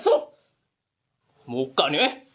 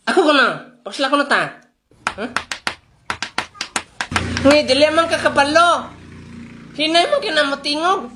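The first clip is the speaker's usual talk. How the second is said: much louder, calling across the yard; raised voice scolding a kid; 7 dB louder than usual. Acted. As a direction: shout to get the boy's attention. Calling out to a boy.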